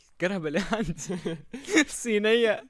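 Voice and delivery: high-pitched guess broken by real laughter; laughing guess. Real, not acted. Player guessing the opponent's car, laughing.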